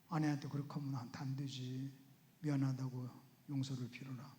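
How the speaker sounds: 낮고 나지막한 내면의 속삭임 흉내; 양심이 타이르듯 낮고 작게; 마음속 목소리. Acted. 마음속에 떠오른 소원의 목소리. (나직이 타이르는 말투)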